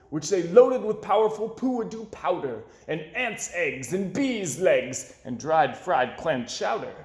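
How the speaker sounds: ordinary delivery, nothing marked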